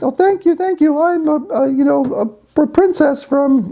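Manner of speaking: high falsetto, hammed-up frog; a squeaky little princess frog. Frog thanks the boy holding her, high falsetto. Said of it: "the frog says".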